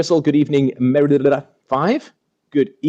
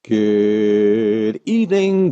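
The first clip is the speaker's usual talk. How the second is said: a slow, lingering welcome; drawn-out greeting; much slower than usual. Acted. Host opens the stream with a very slow greeting. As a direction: stretch good evening out very slowly.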